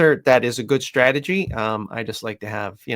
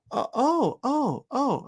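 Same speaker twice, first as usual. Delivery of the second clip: mock dawning realization; 14 semitones higher, much slower than usual. Owners realize, stammering.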